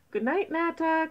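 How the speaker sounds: put-on high-pitched baby mice calling out; calling goodnight. A narrator's reading. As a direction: call a little goodnight, pitched well up. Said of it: "called the baby mice".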